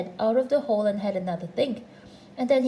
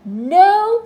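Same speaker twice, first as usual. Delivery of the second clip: high, very loud no; emphatic high shout; shouted refusal; 9 semitones higher, 14 dB louder than usual. A narrator's reading. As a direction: shout it back from inside the hole. Voice shouts back from hole.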